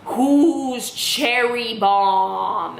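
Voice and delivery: very slow, drawn-out cryptic question; riddling mom. Retold or imitated. Mom repeating her riddle to a baffled daughter, cryptic. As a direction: intone it like a riddle, every word stretched. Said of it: "like it's like a riddle".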